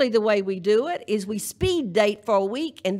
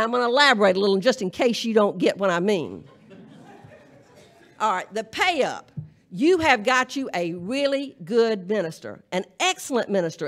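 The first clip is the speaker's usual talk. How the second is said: ordinary delivery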